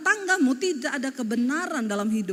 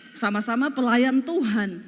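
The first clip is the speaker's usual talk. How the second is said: ordinary delivery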